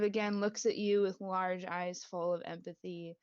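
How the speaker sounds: usual speaking voice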